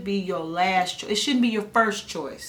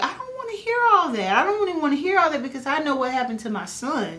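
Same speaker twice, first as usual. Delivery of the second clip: high, fast, fed-up brush-off; telling the doctor she won't hear it, in a high exasperated voice; exasperated refusal; 6 semitones higher, faster than usual. Retold or imitated. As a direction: wave the doctor off, annoyed.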